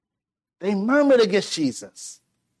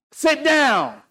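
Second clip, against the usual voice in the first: raised, higher barked order to a son; angry parent command; 6 semitones higher than usual. Retold or imitated. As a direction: call the boy in like a cross father. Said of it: "I come out and say".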